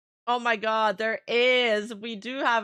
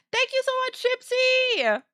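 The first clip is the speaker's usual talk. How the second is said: high excited thanks; 11 semitones higher, 3 dB louder than usual. Real, not acted. Thanking a donor.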